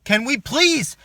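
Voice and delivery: ordinary talk, nothing marked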